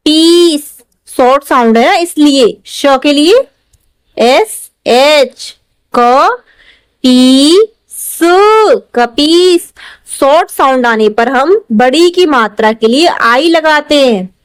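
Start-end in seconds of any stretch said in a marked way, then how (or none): 4.2-6.4 slow dictation. Shown as a demonstration. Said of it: "teacher dictating letters". 7.0-9.7 slow sounding out. Shown as a demonstration. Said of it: "teacher sounds out syllables".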